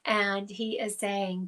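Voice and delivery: ordinary talk, nothing marked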